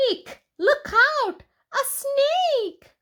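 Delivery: squeaky high mock alarm at a pretend snake; mock fright. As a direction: squeal it like a playful scare.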